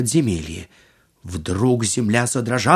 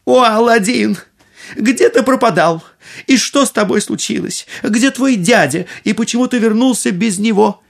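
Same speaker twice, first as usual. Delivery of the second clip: worried mother; 10 semitones higher than usual. A narrator's reading. Mother greets returning son.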